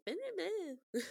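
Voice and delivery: high-pitched mock complaining; whiny nonsense imitation of grumbling listeners; mocking whine. Imitating Americans complaining about the accent, high mocking whine. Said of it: "so many Americans being like".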